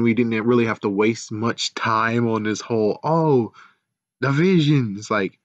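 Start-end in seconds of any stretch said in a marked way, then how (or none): 3.0-5.0 mock awe. Mocking the plot device.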